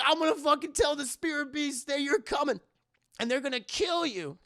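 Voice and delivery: high-pitched mock-villain threat; threatening bat. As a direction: imitate a fleeing bat demon yelling threats. Bat flees shouting a threat.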